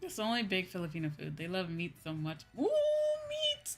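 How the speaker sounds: usual speaking voice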